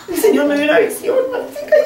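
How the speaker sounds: a touch of crying, voice cracking up high on Martica; retold tearful plea. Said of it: "ella me decía llorando".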